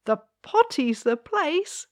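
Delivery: high, proud little princess; proudly. Acted. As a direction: boast it with pride. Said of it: "said the little princess proudly".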